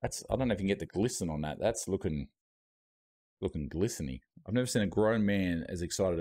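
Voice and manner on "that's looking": normal talking voice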